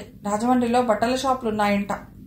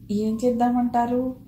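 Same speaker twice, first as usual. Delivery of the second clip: usual speaking voice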